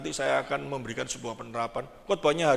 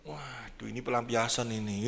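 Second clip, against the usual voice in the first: devil muttering; 6 semitones lower, 7 dB quieter than usual. Retold or imitated. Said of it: "kena ini setannya ngomong nggak".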